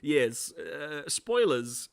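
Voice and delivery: normal talking voice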